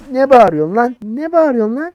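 high, loud indignant yell; angry yelling. Real, not acted. Officer challenging a shouting traveller, angry yelling. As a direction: yell the challenge back, pitched right up.

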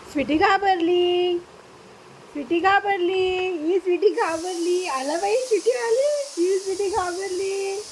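playful coo, words stretched high; teasing pet talk. Real, not acted. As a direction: tease a scaredy pet in a lilting voice. Teasing frightened pet in singsong.

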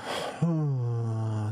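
slow, relaxed audible exhale; long exhaling sigh. Shown as a demonstration. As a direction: let the breath out in one long sigh. Demonstrates exhale in meditation.

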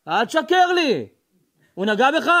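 acted stern father, mildly cross; irritated father questioning. Father questions his son about a teacher, irritated. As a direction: press the child firmly for an answer. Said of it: "עם קצת יותר עצבים".

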